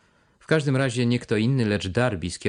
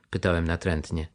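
ordinary delivery, nothing marked

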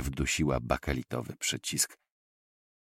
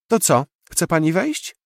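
put-on much higher, louder child's voice; a kid inviting the guest in; small boy; 13 semitones higher, 7 dB louder than usual. (mały chłopiec, dużo wyżej, zapraszająco)

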